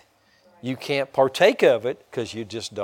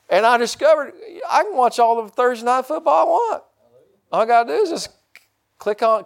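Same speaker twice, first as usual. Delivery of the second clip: gleeful excitement; 11 semitones higher, 4 dB louder than usual. Retold or imitated. Thrilled about Thursday football.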